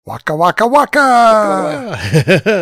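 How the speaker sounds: rising comic bear puppet voice; goofy waka waka call; Fozzie Bear voice. Pretend.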